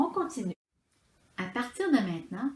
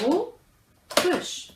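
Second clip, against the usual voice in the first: high "Autruche" split in two; syllable by syllable; 7 semitones higher than usual. Shown as a demonstration. Teacher confirming two syllables, syllable by syllable. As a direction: clear gap inside "Autruche". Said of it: "Combien de syllabes".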